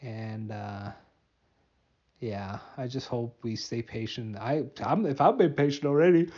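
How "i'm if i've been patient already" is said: talking through a yawn; words stretched by a yawn. Real, not acted. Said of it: "I'm yawning on my podcast".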